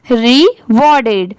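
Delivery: slow rewarded, split "ree... war-ded"; syllable-by-syllable model. Shown as a demonstration. Teacher asks pupils to say it along, slow and split. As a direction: lead a repeat of rewarded, drawn out in parts. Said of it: "बोलिए".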